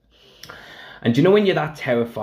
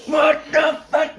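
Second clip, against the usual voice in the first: furious shout; slower than usual. Retold or imitated. Stage manager hears the power news and explodes in fury. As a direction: bellow it in disbelief. John blows up, retold.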